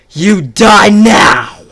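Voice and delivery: high, loud, slow roar of rage; raging battle cry; furious shout. Acted. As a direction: scream the threat as he attacks. Enraged father attacks killers.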